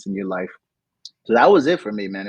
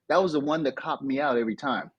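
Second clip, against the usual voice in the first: ordinary talk, nothing marked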